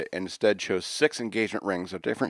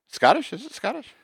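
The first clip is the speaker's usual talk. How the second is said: surprised. Real, not acted. Disbelief at Scottish claim.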